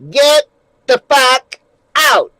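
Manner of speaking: loud, high, slow-spaced imitation of a furious host; acted angry order. Eddie Murphy's character to party guests, loud acted order. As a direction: throw the guests out, word by word, loudly.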